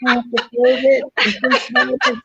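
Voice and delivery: bursting into laughter over the toilet paper line; laughing through words. Real, not acted. Friend laughing about Carol's toilet paper poem, loud laughing talk.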